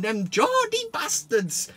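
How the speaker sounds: a bad Geordie accent, dragged out slowly; mock Geordie accent. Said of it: "Shit accent, that".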